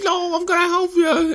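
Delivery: stubborn falsetto whine; very high mocking kid voice; mock whiny son. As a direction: protest like a bratty crybaby. Mocking Choji's whining refusal.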